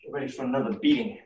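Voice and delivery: usual speaking voice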